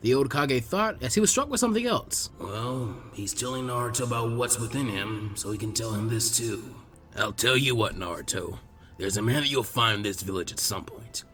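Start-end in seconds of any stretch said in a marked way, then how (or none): 2.4-11.2 put-on lower, calm old man's voice; gentle, low old village elder; old Hokage. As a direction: speak like a patient elderly leader.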